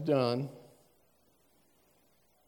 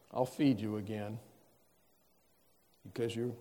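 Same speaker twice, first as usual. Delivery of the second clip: mild soft reassurance; 5 semitones lower, 9 dB quieter than usual. Retold or imitated. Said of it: "He doesn't just say".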